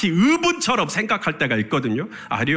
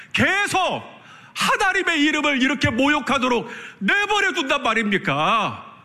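rising, ever higher and more outraged protest; David's righteous anger; 6 semitones higher than usual. Acted. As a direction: build from a disbelieving question to an indignant cry. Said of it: "이 글의 뉘앙스를 살려서 다시 읽어볼게요". (점점 높아지며 분노하는 다윗의 외침)

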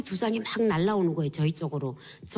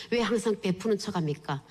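ordinary talk, nothing marked

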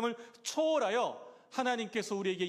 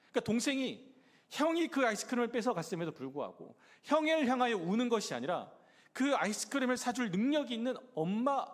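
ordinary delivery

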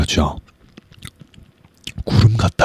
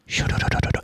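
whispered melting sound; 8 dB quieter than usual. Retold or imitated. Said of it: "녹는 어떤 그 소리가 들리는 것 같아".

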